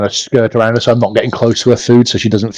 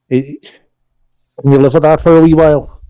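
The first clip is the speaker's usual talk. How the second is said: soothing pet talk; 4 semitones higher than usual. Acted. Character soothing a wolf.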